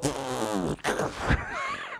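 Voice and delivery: long, low mock snigger; stifled, breath-only scoffing laugh; scoffing snort. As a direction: splutter in mock derision. Sarah's scoffing reaction acted out.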